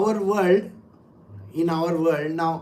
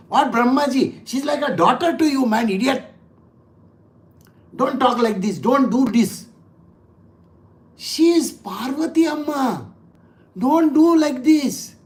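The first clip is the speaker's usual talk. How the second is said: mock scolding; 4 semitones higher than usual. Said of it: "they also get very angry".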